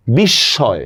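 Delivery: বিস্ময় as "bish-shoy", m silent; doubled consonant. Shown as a demonstration. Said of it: "তার উচ্চারণ দ্বিত্ব হয়".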